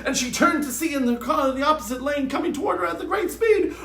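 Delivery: plain reading voice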